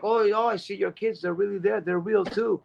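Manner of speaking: high-pitched imitation of a starstruck lady; excited fan. Lady seeing the speaker's kids, high excited gushing. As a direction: gush in a high, thrilled voice. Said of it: "she ran around and she's like".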